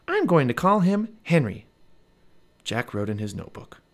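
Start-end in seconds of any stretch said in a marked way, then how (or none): 0.1-1.7 put-on little girl voice. A narrator's reading. Narrator voices Annie.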